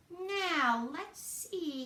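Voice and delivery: high, wondering bunny voice; lightly voiced young rabbit thinking aloud; pondering bunny. A narrator's reading. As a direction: puzzle it out as the little bunny. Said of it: "he pondered".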